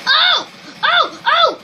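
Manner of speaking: high loud repeated cries; excited yelps. Real, not acted. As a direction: yelp each one at the top of your voice. Excited cries during fight.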